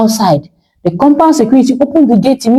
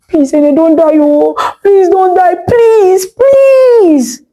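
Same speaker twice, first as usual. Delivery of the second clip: pleading that climbs higher and breaks into a sob; husband begging his wife to live, crying; crying plea; 9 semitones higher, slower than usual. A narrator's reading. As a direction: beg her not to die through tears. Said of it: "He was crying".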